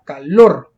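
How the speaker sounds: calor with a loud, heavy final syllable; last-syllable stress. Shown as a demonstration. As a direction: punch the -lor in calor. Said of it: "fuerza de voz en la última sílaba".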